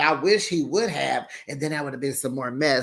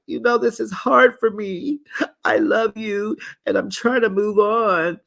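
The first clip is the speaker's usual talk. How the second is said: tearful; 7 semitones higher, 3 dB louder than usual. Retold or imitated. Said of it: "she was like".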